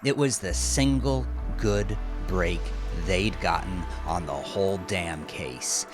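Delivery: usual speaking voice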